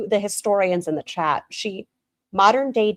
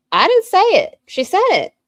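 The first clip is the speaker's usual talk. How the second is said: much higher and louder, playful denial; mock high squeal; 11 semitones higher, 6 dB louder than usual. Shown as a demonstration. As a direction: squeak it out like a cartoon.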